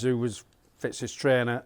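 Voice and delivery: ordinary talk, nothing marked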